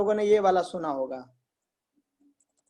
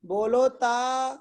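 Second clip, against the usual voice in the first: lisping teacher; 3 semitones higher, much slower than usual. Retold or imitated. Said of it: "तो teacher बोलता है".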